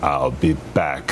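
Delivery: low, deliberate Terminator delivery; menacing catchphrase, as in the film; Terminator movie line. Retold or imitated. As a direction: drop deep and space out "I'll be back". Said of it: "the way you said it in the movie".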